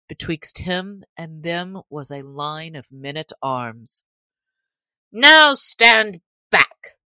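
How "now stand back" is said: slow, booming sheriff's command; mighty shout. A narrator's reading. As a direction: bellow it at the crowd. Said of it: "cried the sheriff in a mighty voice".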